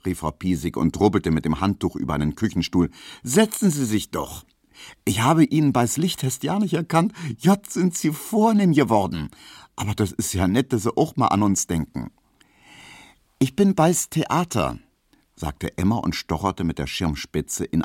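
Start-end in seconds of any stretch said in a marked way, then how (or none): 3.2-12.1 high, gushing Berlin housewife voice; a talkative landlady, thrilled by the visit; chatty Berlin landlady. Acted. Landlady Piesecke welcomes Emma. 13.4-14.8 put-on high, boastful young woman; proud young Emma. Emma announces her stage job.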